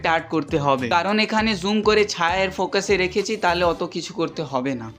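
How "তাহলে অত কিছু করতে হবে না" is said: ordinary delivery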